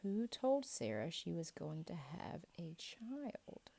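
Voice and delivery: the question repeated in a whisper. Shown as a demonstration. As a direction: lean in and whisper it again. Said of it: "quiz question repeated softly".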